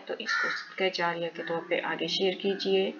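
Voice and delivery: normal talking voice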